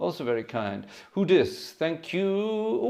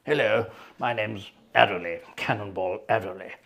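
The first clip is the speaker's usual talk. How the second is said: a lightly voiced British army major, a bit lower; touch of a posh-sounding officer, slightly lower; 3 semitones lower than usual. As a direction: put on a hint of a stiff British major introducing himself. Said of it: "a major in the army".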